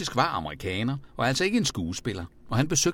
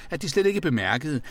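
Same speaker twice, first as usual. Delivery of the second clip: ordinary delivery, nothing marked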